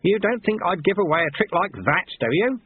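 high, scoffing question; lightly acted indignation. Old master scoffs at pupil.

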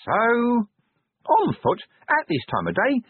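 very high, tiny, nervous chirp; small squeaky fretful creature; imp voice. Acted. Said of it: "said the imp".

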